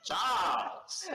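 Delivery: high, playful yell; mock shout. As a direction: shout out the name in mock drama. Mock calling out of character's name.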